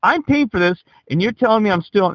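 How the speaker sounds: high, outraged protest; insisting he paid for it; indignant protest. Retold or imitated. Retold protest against theft claim.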